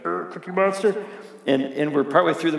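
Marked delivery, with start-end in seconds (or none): 0.0-1.0 higher put-on growly puppet voice; Cookie Monster. Dad reading to his son, doing monster voices. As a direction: do a silly monster character voice. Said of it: "one night I was doing voices".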